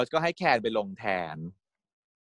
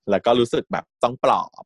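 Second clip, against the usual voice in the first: usual speaking voice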